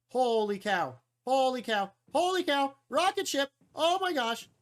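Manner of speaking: growing wonder from calm to thrilled; escalating holy cow; building amazement. Real, not acted. Repeated amazed reaction.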